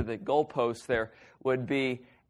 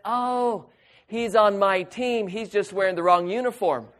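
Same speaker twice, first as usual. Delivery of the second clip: delighted surprise; 6 semitones higher than usual. Retold or imitated. Relieved reply about king snake.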